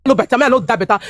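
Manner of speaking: normal talking voice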